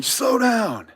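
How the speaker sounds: high, loud drawn-out holler; imitated yell. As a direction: mimic a man yelling at a driver. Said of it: "he's, like, yelling at me".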